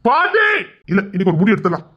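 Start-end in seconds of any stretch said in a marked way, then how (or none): 0.0-0.4 high-pitched rising yell; calling out. Acted. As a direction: shout for grandmother. Calls out for grandmother.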